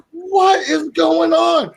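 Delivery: high, laughing panicked yell; panicked shout. Retold or imitated. Telling how his partner left him alone, panicked laughing yell. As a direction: shout it like a rookie who just got ambushed.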